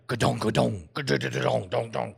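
low thudding clatter noises; imitated chimney rattling. Man imitating the creature in his chimney, low clattering thuds. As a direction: knock out hollow bumping sounds by mouth. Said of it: "because we would hear".

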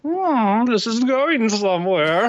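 intrigued onlooker. Acted. Game master voices the watcher above, intrigued. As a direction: draw it out with growing interest. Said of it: "Oh, you hear".

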